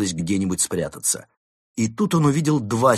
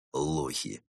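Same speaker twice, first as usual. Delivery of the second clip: low, very soft muttered jibe; barely audible sneer; 8 semitones lower, 9 dB quieter than usual. A narrator's reading. Second wolf jeering at the police, barely audible. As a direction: sneer it under your breath. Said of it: "еле слышно усмехнулся".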